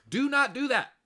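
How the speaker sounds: high, loud shout of warning. Real, not acted. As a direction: yell it to stop them.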